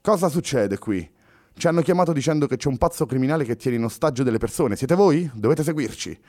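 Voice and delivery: suspicious officer questioning passengers on a train; brusque policeman. Acted. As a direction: confront the suspects like a suspicious cop.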